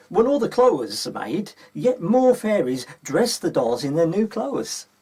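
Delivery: ordinary delivery, nothing marked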